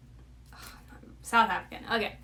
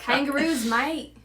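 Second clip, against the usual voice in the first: higher, louder Aussie attempt drifting British; Australian accent attempt; 4 semitones higher, 4 dB louder than usual. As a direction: try Aussie and slip toward British. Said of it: "I just sounded British".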